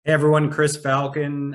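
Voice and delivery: ordinary talk, nothing marked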